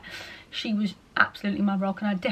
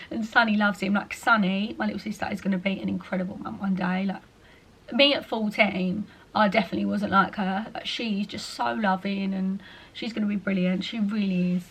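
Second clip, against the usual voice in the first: ordinary talk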